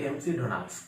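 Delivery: एमसी डोनाल्ड्स with letters M-C read out; spelled-out mispronunciation. Shown as a demonstration. Teacher quotes what some people say, wrong pronunciation. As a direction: say एमसी as "em-see", then "donalds". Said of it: "कुछ लोग बोलते हैं".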